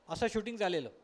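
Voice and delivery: ordinary talk, nothing marked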